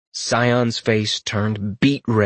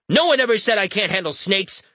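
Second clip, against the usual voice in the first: angrier; 9 semitones higher than usual. Acted. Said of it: "Scion said with even more anger".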